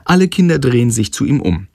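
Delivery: plain reading voice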